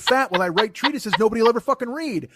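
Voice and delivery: retold self-mocking outrage; indignant rant. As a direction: build a mock-outraged rant. Comic rant at friend.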